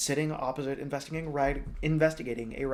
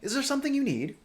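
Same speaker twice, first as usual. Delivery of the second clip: higher polite inquiry; courteous; 12 semitones higher, 4 dB louder, faster than usual. Acted. The king politely asking Calcifer, higher voice. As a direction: ask graciously. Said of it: "he said courteously".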